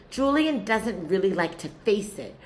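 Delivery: ordinary talk, nothing marked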